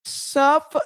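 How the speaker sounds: high rising suffer; a stretched falsetto-style suffer rising at the end; high note. Retold or imitated. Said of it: "He hits that high note better".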